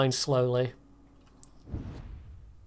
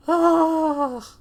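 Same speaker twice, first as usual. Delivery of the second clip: surprised cry; 6 dB louder than usual. Real, not acted. Reacts to hit.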